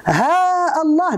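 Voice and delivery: "ها الله" with a drawn-out haa, then a glottal stop starting Allah; long vowel, cut hamza. Shown as a demonstration. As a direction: hold the aa of "ها", then break cleanly before "الله". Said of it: "بالمد والقطع". (مدّ ألف «ها» مع قطع همزة «الله»)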